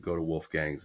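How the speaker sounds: ordinary talk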